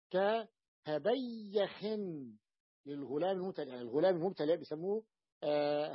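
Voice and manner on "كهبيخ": "كهبيخ" very slow, ka-ha-bay-yakh; open ya demo. Shown as a demonstration. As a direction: stretch the double y with fatha in "كهبيخ". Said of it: "إذا الياء الأولى مفتوحة". (نطق "كهبيخ" ببطء بياء مشددة مفتوحة)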